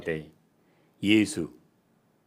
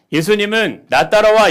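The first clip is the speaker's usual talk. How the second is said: loud, high preacher's cry; full-force sermon shout; fiery preaching; 12 semitones higher, 11 dB louder than usual. Acted. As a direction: preach it hard.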